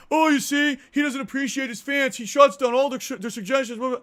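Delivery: high, loud whiny mock voice; whiny mocking chatter. Streamer mocks toxic chatters, high and whiny. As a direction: mimic the nagging chatter.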